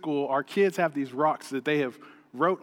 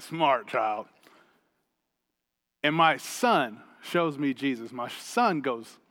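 ordinary delivery

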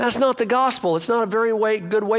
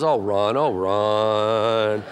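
a hint of a lower, gentle God voice; lightly voiced God; 10 semitones lower, much slower than usual. God looking down at the pastor, lightly voiced fond sigh. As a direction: sigh his name like a patient father. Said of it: "God looks down and goes".

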